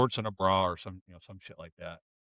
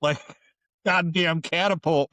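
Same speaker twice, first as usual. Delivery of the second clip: ordinary talk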